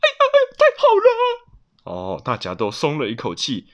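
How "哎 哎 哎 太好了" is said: very high, loud, quick exclamation; excited high cry of relief; overjoyed relief. Acted. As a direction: burst out with squealing relief. Relief at finding the lost chick.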